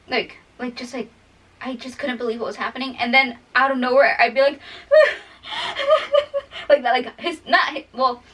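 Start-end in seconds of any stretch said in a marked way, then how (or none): 5.5-6.6 sudden sob-laugh burst. Retold or imitated. Shows her sudden crying burst.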